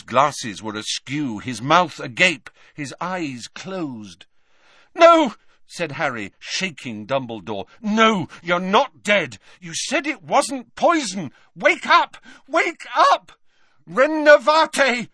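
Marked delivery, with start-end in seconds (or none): 4.9-5.3 high, loud burst of denial; alarmed cry. A narrator's reading. Harry shaking Dumbledore, panicked cry. 7.8-15.1 high, loud panic rising to a spell; plead, then cry the spell out; Harry crying out. A narrator's reading. Harry trying to revive Dumbledore, panicked cries. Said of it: "he cried".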